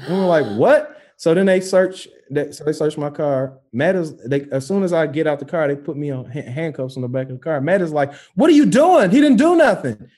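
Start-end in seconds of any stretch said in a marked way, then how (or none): 0.6-1.0 high, loud questioning jump; incredulous. Retold or imitated. Driver told his car is thought stolen, incredulous. 8.4-10.1 high, loud imitation of outraged friend; loud protest. Friend objecting as cops handcuff the driver, outraged protest.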